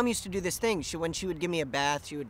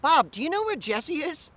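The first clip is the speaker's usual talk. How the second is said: higher, lightly singsong imitation of mom; mom playing clueless; 9 semitones higher than usual. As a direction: play-act not knowing where baby is. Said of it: "go up to my dad and be like".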